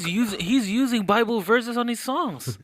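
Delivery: high, loud mock protest; sarcastic. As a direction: exclaim it in exaggerated disbelief, sarcastically. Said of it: "I'm being sarcastic".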